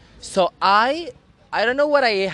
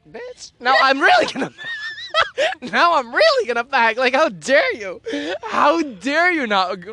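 mock outrage; 7 semitones higher, 4 dB louder than usual. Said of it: "so I'm like".

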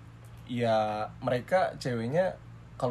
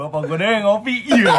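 laughing; 7 semitones higher, 11 dB louder than usual. Real, not acted. Joking about coffee with sambal.

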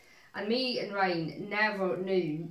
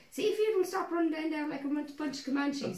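high-pitched imitation of a parent's warning; high, mock parental threat; 8 semitones higher than usual. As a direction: mimic a scolding parent, pitched up. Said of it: "when they say".

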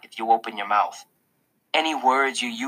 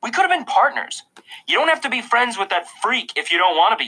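high-pitched schoolboy protest; indignant complaint; 11 semitones higher than usual. A narrator's reading. Julian to classmate about partners, indignant. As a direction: argue it in a raised, higher voice. Julian argues with narrator at stairs.

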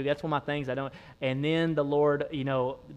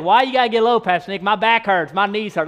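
loud, high whiny churchgoer; mock complaining churchgoer; 6 semitones higher, 10 dB louder than usual. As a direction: whine like a sore-kneed member. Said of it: "You might be like".

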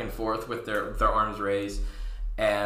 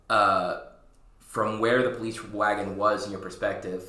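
ordinary talk

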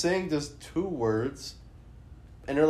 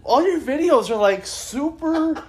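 incredulous laughing; 9 semitones higher than usual. Real, not acted. Comedian reacting to an absurd music video, incredulous laughing. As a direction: crack up in astonished bursts, words pitched way up.

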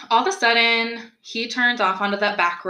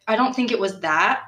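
ordinary talk

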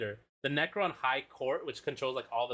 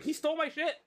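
whiny complaint; 13 semitones higher than usual. Retold or imitated. Complaining to the court.

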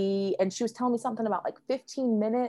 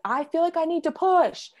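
much higher, imitated urgent call; urgent cry; 9 semitones higher, 4 dB louder than usual. Labouring mother telling nurses she must push, urgent cry. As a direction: call it out, rising as the urge hits.

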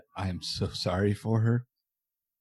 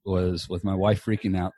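usual speaking voice

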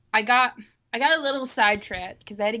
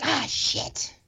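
whispered disappointment; 2 semitones higher, 7 dB quieter than usual. Retold or imitated. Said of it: "I'm like".

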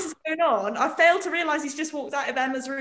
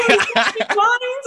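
high laughing "do you mind"; dad mimicking her politeness; mock prim; 8 semitones higher, 7 dB louder than usual. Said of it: "he was like".

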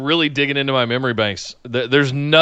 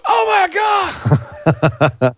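stunned gasp. Retold or imitated. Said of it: "I was just going".

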